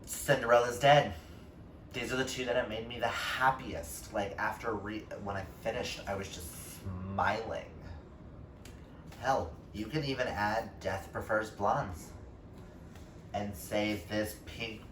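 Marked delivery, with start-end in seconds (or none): none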